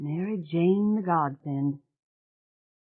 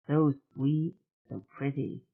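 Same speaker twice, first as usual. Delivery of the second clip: usual speaking voice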